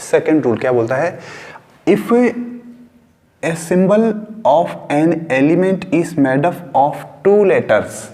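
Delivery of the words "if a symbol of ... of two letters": very slow, spaced rule read for notebooks; slow dictation. Shown as a demonstration. Teacher dictating the second rule to students, very slowly. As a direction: dictate word by word with pauses. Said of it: "वो आप लोगों को अपनी notebook के अंदर लिखना है".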